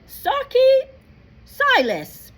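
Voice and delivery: high, loud cheering calls; twins cheering. Acted. Ron's twin brothers cheer from the Gryffindor table, loudly. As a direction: cheer your new housemates on. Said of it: "Ron's twin brothers calling".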